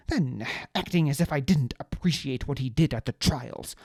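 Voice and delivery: plain reading voice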